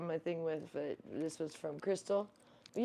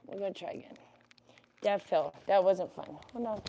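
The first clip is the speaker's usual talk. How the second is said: usual speaking voice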